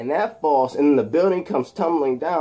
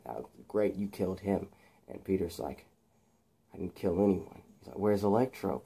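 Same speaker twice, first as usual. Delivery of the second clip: soft, low taunting villain; soft, low sinister taunting; menacing Harry; 5 semitones lower, 9 dB quieter than usual. Acted. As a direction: taunt him quietly.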